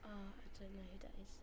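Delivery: breathy whisper, no voice. Real, not acted. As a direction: whisper it under your breath. Whispered reply.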